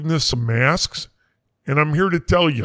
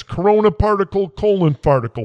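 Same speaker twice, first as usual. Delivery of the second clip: ordinary delivery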